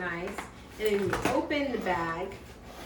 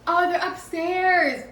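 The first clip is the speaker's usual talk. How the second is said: high, loud, drawn-out reply; raised stretched-out call; calling back; 7 semitones higher, 7 dB louder, slower than usual. Real, not acted. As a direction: holler it slowly up through the house. Calls back about where bag is.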